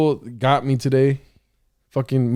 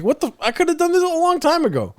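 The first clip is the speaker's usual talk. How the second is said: much higher, incredulous; exasperated disbelief; 13 semitones higher than usual. Retold or imitated. To the worker after the long wait, incredulous and high. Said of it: "I'm like".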